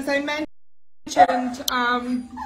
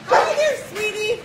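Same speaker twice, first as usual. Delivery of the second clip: much higher, cooing hello to puppies; 8 semitones higher than usual. Real, not acted. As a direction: squeak a hello to the pups. Greeting puppies in high coo.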